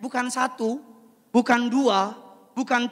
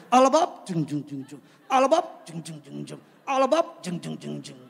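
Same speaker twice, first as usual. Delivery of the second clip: mock party beat; 5 semitones lower, 4 dB louder than usual. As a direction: imitate dance music. Mocking party music. (tiruan musik pesta)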